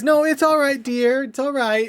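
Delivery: put-on mother calming a little girl; soothing a child. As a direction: hush a crying child, "it's all right, dear". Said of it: "she goes".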